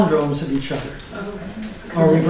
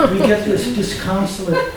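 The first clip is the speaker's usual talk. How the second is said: ordinary delivery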